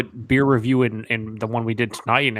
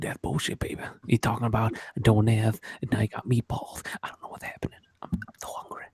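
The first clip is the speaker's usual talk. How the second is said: mock ASMR whisper; 6 dB quieter than usual. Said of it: "A S M R".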